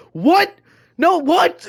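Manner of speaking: high, loud mock-outraged yell; imitated screaming. Friend imitates Emmett answering the band director, screaming. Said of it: "like, screaming at him".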